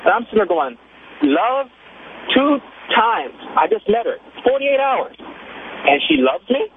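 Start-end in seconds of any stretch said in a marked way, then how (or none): 1.2-6.6 retold disbelief, pitched up in bursts; incredulous. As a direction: question it word by word, baffled. Retelling his disbelief at her love.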